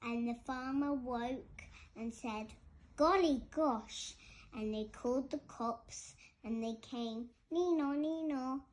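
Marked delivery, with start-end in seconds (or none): 3.0-4.1 loud, higher storybook exclamation; startled farmer. A narrator's reading. 7.5-8.6 loud, higher two-note siren; imitated police siren. A narrator's reading.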